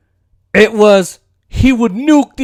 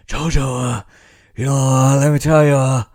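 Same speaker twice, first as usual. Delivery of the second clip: lower, softer put-on old man; mock president voice; 6 semitones lower, 3 dB quieter than usual. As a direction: croon the nickname like a chummy old politician. Mocking president using a nickname.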